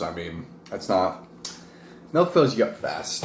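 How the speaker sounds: normal talking voice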